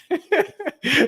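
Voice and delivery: sudden high laugh bursting out; burst of laughter. Real, not acted. Laughing at his own Goldilocks joke, high burst. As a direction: laugh out loud at yourself.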